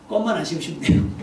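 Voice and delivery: normal talking voice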